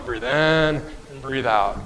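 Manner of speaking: drawn-out, slow breath cue, words stretched long; slow, even coaching of the breath rhythm. Shown as a demonstration. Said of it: "Keep telling you".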